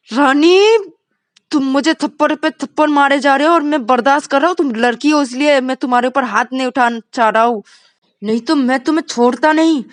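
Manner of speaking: loud, high restrained fury; angry warning. Acted. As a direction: warn her he's had enough. Vikas warns Rani. (गुस्से भरी चेतावनी)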